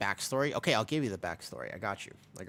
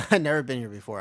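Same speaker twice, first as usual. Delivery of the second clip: laughing as you speak, louder; amused; 8 dB louder, faster than usual. Real, not acted. Restarting a story for the viewers, amused laugh. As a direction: let a laugh break into the words.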